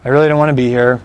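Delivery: low, bored monotone reading. Pretend. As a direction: drone it out with no interest. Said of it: "reads in a monotone voice".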